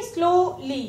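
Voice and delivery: स्लोली softly split into "slow", "lee"; a gap before the ली in स्लोली; syllable by syllable. Shown as a demonstration. Teacher showing pupils the new word, स्लोली split in two. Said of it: "two syllable है".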